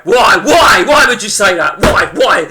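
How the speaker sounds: loud, repeated exasperated why; loud protest. Real, not acted. Streamer reacting to a chat message, loud protest. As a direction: cry out each why in disbelief.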